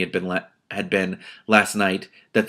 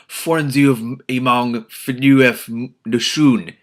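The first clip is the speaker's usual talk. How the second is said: slow, louder ogre growl in a made-up tongue; growled ogre speech; 3 semitones higher, 6 dB louder, much slower than usual. Acted. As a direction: growl the ogre words slowly. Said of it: "he growled".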